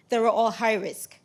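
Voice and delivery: ordinary talk, nothing marked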